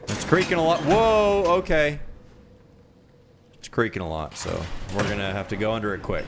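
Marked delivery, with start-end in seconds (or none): 0.0-2.0 higher, louder alarmed gasp; startled. Real, not acted. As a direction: jolt as it creaks. Lifting creaking gate, startled.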